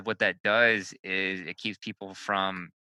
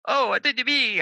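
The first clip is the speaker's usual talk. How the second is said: high, loud Italian wife impression; Italian accent; 5 dB louder than usual. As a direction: imitate her Italian lilt. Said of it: "Ilaria will just be like".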